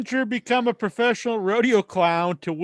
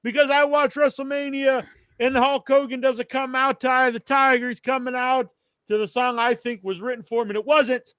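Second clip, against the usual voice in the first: high-pitched mock outrage; mock moaning; 7 semitones higher than usual. Mocking internet fans upset over a theme song, moaning. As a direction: wail it like a griping internet fan. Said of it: "bitching and crying and moaning, saying".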